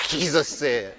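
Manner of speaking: real laughter breaking into the words; laughing. Real, not acted. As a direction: crack up while trying to carry on. Cracks up while resuming.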